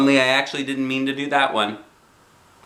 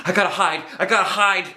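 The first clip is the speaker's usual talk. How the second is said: high, urgent panic; frantic to hide; panicky; 10 semitones higher, faster than usual. A narrator's reading. Girl panicking before Mother comes.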